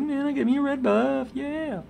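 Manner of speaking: high, pleased mock cheer; a lightly imitated happy cheer; excited. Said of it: "I was like".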